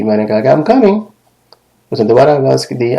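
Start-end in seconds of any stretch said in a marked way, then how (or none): 0.5-1.0 high-pitched answering call; calling back. Acted. Answering someone calling from outside, high call back. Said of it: "کسی نے مجھے باہر سے آواز دی".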